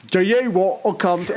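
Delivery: imitating an Australian singer's accent; a lightly done Australian accent; mock Australian accent. Podcast host mocking an Australian singer's Australian accent. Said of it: "There's some accents that are just".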